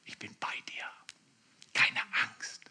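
soft, comforting whisper in the ear. Pretend. Holy Spirit whispering comfort to the believer, hushed. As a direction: whisper reassurance close to the ear. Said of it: "flüstert dir ins Ohr".

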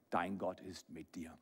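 ordinary talk, nothing marked

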